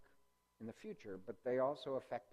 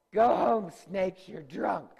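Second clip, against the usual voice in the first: mock shout; 7 semitones higher, 15 dB louder, slower than usual. Joking meme quote to class.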